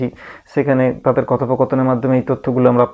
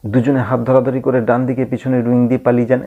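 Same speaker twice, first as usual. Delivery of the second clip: ordinary talk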